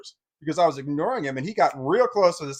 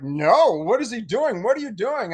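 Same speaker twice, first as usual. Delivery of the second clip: louder, higher exasperated demand; husband half-shouts at the boy with scissors; raised protest; 7 semitones higher, 6 dB louder than usual. Retold or imitated. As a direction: throw it out, getting loud and fed up. Husband confronts him.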